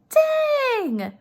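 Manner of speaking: high, sudden burst of disbelief; shocked exclamation. Real, not acted. Teacher reacting to the wife's rudeness, shocked. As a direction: gasp it out like you can't believe it.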